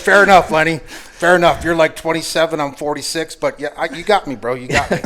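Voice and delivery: high, loud laughing concession; cracking up while conceding; laughing through words. Real, not acted. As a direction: laugh your way through giving in. Retelling punchline, laughing hard.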